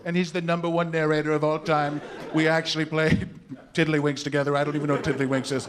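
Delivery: level, self-satisfied bragging; boastful politician impression. Candidate claiming to play games with the narrator, boastful impression. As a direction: brag steadily about a famous pal. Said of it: "he'll say".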